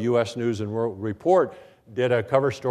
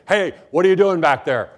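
loud, higher-pitched teacher's reprimand; raised voice; 5 semitones higher, 6 dB louder than usual. Retold or imitated. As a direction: imitate a teacher calling out a misbehaving student. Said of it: "I'd raise my voice and say".

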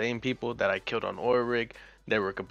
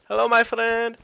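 higher, louder Mexican accent take; 11 semitones higher, 9 dB louder than usual. Shown as a demonstration. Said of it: "I can do, like, a".

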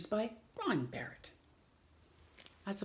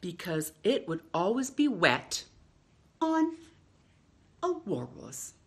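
lively picture-book read-aloud with long pauses; reading to children; slower than usual. Acted. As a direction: read it like story time for little ones.

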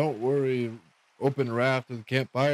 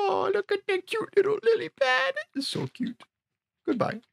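much higher squeaky coo, near tears; cooing over cute thing. Real, not acted. Gamer cooing at a cute lily pad, squeaky high coo.

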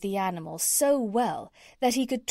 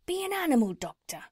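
lightly voiced tradesman urging, higher and quicker; a quick, raised, urging sales pitch in light character; cat's meat man; 7 semitones higher, faster than usual.

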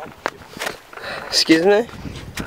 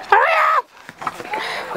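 squeaky yell; much slower than usual. Real, not acted. Impatient at trick attempts.

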